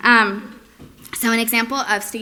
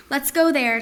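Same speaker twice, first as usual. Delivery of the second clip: playful model phrase; 5 semitones higher, 4 dB louder than usual. Shown as a demonstration. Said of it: "something like".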